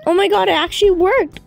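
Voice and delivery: quick, high excited exclamation; amazed. Pretend. Kid gets the lab machine working, amazed. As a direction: blurt it out fast and high in astonishment.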